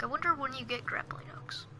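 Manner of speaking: hushed murmur to himself; breathy unvoiced aside; whispering. Real, not acted.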